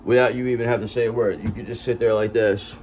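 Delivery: normal talking voice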